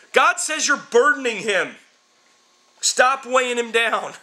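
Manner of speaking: mock preacher. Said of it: "Guy named Burden was running around going".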